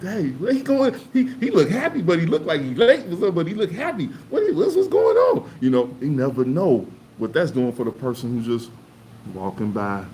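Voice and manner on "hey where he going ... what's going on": high, loud puzzled onlooker voice; curious stranger, way up high; imitating a passerby. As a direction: wonder aloud who the happy guy is. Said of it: "somebody be like".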